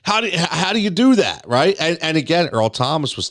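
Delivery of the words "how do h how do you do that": high, disbelieving mock question; imitating fans baffled by the pick; incredulous fans. Host imitating fans baffled by a draft pick, high disbelief. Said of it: "everyone's like".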